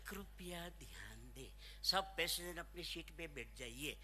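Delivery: soft, low, sugary Hindi announcement; sweet air hostess. Retold or imitated. Mimicking the air hostess's Hindi announcement, softly sweet. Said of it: "એવું મીઠું મીઠું બોલો".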